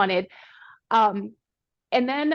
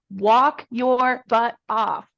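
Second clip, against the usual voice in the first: "Walk your butt off" said slowly, each word hit and louder; slow emphatic command; 5 dB louder, much slower than usual. Real, not acted. Guest coaching listeners to exercise, slow and emphatic. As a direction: lay down the rule word by word. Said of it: "coaching listeners to walk".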